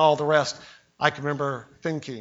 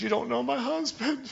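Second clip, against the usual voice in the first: woman's excuse; 8 semitones higher, 5 dB quieter than usual. Retold or imitated. Lady's excuse.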